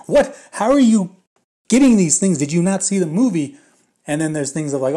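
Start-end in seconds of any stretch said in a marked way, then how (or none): 0.1-3.6 much higher and louder, stunned what; baffled outburst at a silly claim; incredulous disbelief. Retold or imitated. Retelling his reaction to absurd claims.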